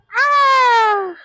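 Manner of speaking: long high playful "aa" cry; a high sustained "aa" to amuse the child; playful call. Shown as a demonstration. Mother amusing her daughter in the park with a long high "aa". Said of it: "अपनी बेटी को दिखाने के लिए".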